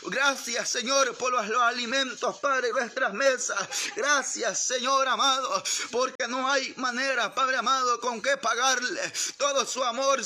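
high, loud, insistent praying voice; fervent prayer. Real, not acted. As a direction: cry out to God in rhythmic, pleading phrases.

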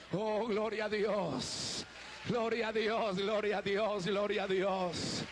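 rising, shouted preacher's cries of praise; full-force preaching. Acted. Preacher rousing the congregation, shouting praise. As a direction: preach it out to the whole church.

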